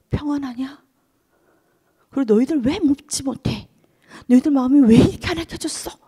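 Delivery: Jesus scolding the disciples for their unbelief. Acted. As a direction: chide the doubting disciples. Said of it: "야단을 하시면서".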